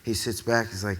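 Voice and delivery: ordinary talk, nothing marked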